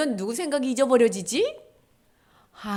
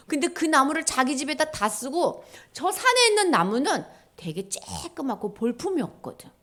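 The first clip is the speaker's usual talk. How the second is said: ordinary talk, nothing marked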